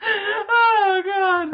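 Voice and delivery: very high, loud fake sob; mock anguish. Fan mourning his dead actor, mock anguish. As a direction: cry out in pretend despair.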